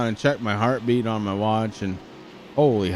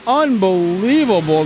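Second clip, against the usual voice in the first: slow, loud, amazed shout; drawn-out amazed finish call; excited shout; 9 semitones higher, 8 dB louder, slower than usual. Real, not acted.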